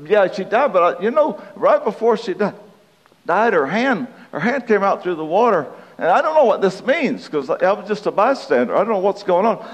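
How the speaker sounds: high-pitched eyewitness voice; hammed-up bystander, high and animated; puzzled bystander. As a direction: recount it as a baffled onlooker, pitch up. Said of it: "somebody standing by says".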